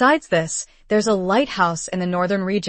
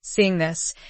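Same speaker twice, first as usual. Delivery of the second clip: ordinary delivery, nothing marked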